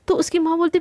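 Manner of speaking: plain reading voice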